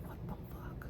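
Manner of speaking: breathless whispered what the fuck; stunned hushed whisper; astonished whisper. Retold or imitated. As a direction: whisper it in disbelief.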